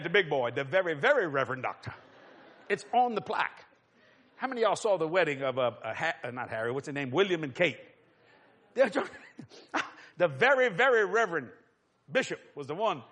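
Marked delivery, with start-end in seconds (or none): none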